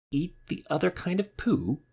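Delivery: high, disbelieving echo of the question; shocked disbelief. Acted. Rabbit recoiling at Bear's question, high and appalled. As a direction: Rabbit can't believe what Bear asked, appalled. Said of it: "That's disgusting".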